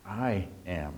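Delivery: "I" drawn out and set apart, softly; stress on I. Shown as a demonstration. Preacher letting one word sink in, stress on "I". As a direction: dwell on "I", then let "am" drop quietly. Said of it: "emphasize one word in that".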